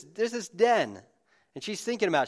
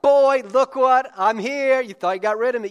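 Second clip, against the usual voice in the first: arrogant gloating; 10 semitones higher, 12 dB louder than usual. Pretend. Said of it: "a bit arrogant and up in his face".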